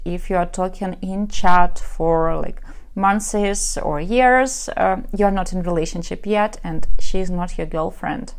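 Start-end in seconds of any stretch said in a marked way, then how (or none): none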